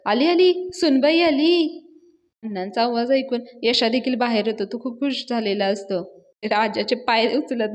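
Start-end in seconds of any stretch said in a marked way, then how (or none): 0.1-1.8 loud, high, joyful cry, drawn out at the end; Anna crows loudly so the neighbours hear; gleeful shouting. Acted. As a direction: shout the news in triumph. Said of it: "अण्णा पण मोठ्या मोठ्यानं ओरडतात".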